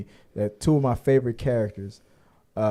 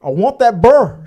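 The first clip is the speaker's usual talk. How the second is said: imitating a stubborn Southern woman demanding her bear; half-shouted Southern accent impression; Southern accent quote; 4 semitones higher, 9 dB louder than usual. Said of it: "she has a very deep Southern accent".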